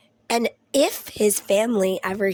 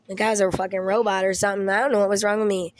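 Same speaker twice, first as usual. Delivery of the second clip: ordinary talk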